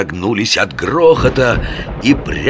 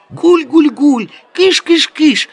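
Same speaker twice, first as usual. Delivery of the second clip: high, loud shooing calls; shooing pigeons; 7 dB louder, slower than usual. Retold or imitated. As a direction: shoo them off like pigeons. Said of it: "так и кричал, как на голубей".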